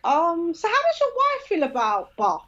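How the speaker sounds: high, mock-innocent question; sly probing. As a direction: fish slyly for information, pitch lifted. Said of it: "the sly woman thing where I'm like".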